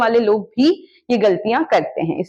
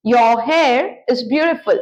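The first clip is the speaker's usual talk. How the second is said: slow, clear teacher read for repeating; deliberate classroom model line; slow model sentence; much slower than usual. Shown as a demonstration. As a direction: say it slowly so they can repeat. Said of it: "इनको please repeat कीजिए".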